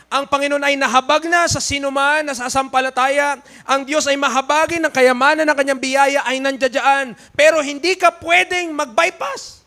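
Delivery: loud, high fervent preaching. Acted. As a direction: preach it out with fire. Preaching at full force. (masigasig na pangangaral)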